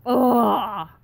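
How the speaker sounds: loud mock groan of disgust; sudden loud, grossed-out noise. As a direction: recoil from a foul stink with a big "ugh". Imitates reaction to farm stink.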